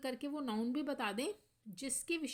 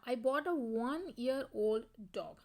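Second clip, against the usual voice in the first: very slow, each word spaced out; slow dictation; 4 dB louder, much slower than usual. Shown as a demonstration. Teacher reading the example sentence aloud to students, very slow. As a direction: spell it out for the class.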